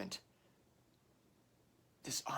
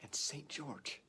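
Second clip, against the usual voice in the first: hushed battle cry; 2 semitones higher, 7 dB quieter than usual. Acted. Battle cry ends hushed after pause.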